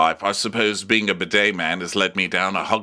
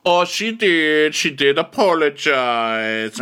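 slow, drawn-out mock pronouncement; Judge Jenkins; 6 semitones higher, 4 dB louder, slower than usual. Judge Jenkins backing up the apology, slow caricature. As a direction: stretch it out higher, in caricature. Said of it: "And Judge Jenkins is like".

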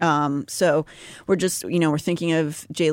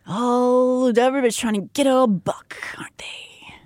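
conspiratorial whisper; 4 semitones higher than usual. Acted. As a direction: whisper it, sly and hushed.

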